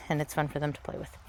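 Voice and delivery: soft breathy aside; whispering. Real, not acted. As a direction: drop to a whisper.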